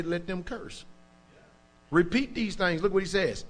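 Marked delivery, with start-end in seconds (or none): 0.0-0.8 much softer, said under the breath; quiet prayer. Shown as a demonstration. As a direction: murmur it to God in private. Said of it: "you go to Yahweh and say".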